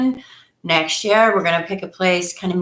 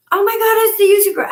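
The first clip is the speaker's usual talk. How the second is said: put-on much higher, fast, fan-girl gush; excited recognition; 14 semitones higher, 5 dB louder, much faster than usual. Beachgoers recognizing the YouTubers, fast high gush. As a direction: rattle it off like a starstruck fan. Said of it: "everybody was like".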